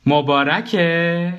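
playful, stretched-out question; mischievous. A narrator's reading. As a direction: ask it slowly and impishly. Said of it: "با لحن شیطنت آمیزی پرسید".

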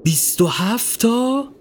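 high, slow astonished question; open-mouthed amazement. A narrator's reading. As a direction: stretch it out and lift the end in wonder. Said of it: "دهان یورگن از تعجب باز ماند".

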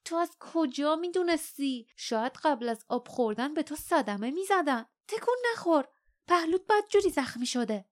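sharp, high-pitched maternal rebuke; snapping mother. A narrator's reading. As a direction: snap back at the child, worried and cross. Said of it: "مادرش پرخاش کرد".

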